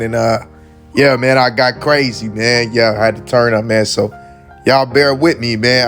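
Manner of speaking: ordinary delivery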